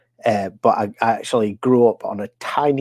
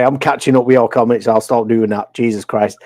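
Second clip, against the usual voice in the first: ordinary talk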